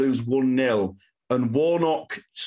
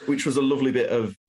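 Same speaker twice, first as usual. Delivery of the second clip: ordinary talk, nothing marked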